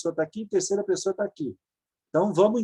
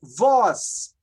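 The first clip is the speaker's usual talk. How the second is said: ordinary delivery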